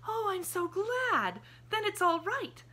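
put-on high child's voice, slowed in happy relief; a little girl sighing with joy; relieved little girl. A narrator's reading. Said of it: "sighed the little girl".

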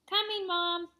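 calling out loud and high; yelled. A narrator's reading. Boy calling to his mom upstairs, loud and high. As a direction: shout it up the stairs to Mom. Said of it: "I yelled".

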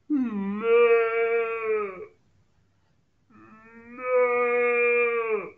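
long, loud drawn-out moo; cow moo. Shown as a demonstration. Teacher showing preschoolers the cow sound, mooing. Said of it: "it makes a sound like this".

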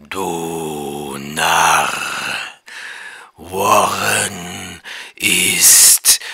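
hollow, very low, drawn-out monster voice; deep inhuman voice. A narrator's reading. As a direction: speak slowly from far underground, bodiless and deep. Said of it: "dass die Stimme tief war". (tiefe, hohle, unmenschliche Stimme)

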